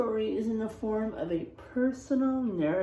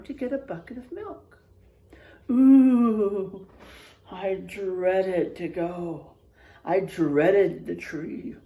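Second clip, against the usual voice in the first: spooky story narration; 2 semitones lower, 5 dB louder than usual. A narrator's reading. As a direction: read it like a campfire tale. Reads spooky story aloud.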